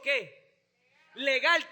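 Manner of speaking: ordinary delivery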